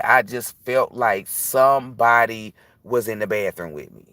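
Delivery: ordinary talk, nothing marked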